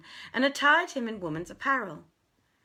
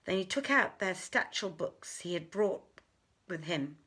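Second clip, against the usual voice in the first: ordinary delivery, nothing marked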